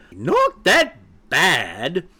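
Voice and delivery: high, slow, incredulous echo; squawk. A narrator's reading. Mother hen echoes chick's defence, squawking. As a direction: throw it back in drawn-out disbelief. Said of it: "squawked Sapphira even louder".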